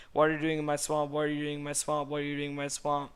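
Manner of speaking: a hammed-up Shrek yelling the line; Shrek impression. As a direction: rant like an angry ogre. Shrek's famous line repeated.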